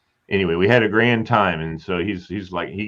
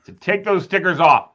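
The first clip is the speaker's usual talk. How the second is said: repeated louder, getting impatient; 6 semitones higher, 6 dB louder than usual. Real, not acted. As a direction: say it again louder so he hears. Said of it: "He's ignoring me".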